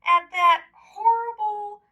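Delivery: mean-spirited mocking voices; mocking ducks. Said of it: "They said".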